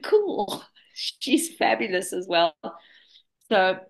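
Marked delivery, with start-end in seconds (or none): 0.0-0.6 a sudden high, bright "Cool."; high pitched cool. Retold or imitated. Telling a friend she patches too, squeaky delighted cool. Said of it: "I went".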